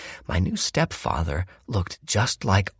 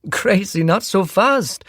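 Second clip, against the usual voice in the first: higher, slow, smooth Welsh-tinged stepfather; smooth charming stepdad covering something; Ted's round tenor; 5 dB louder, slower than usual. A narrator's reading. Ted teases mother.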